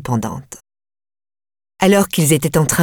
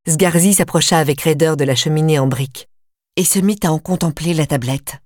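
usual speaking voice

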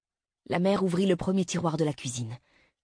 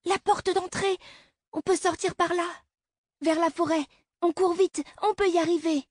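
put-on high, loud pleading child; a daughter begging her mother to flee; urgent little girl; 13 semitones higher, 3 dB louder than usual. A narrator's reading. Girl urges escape. (fillette pressante, voix aiguë)

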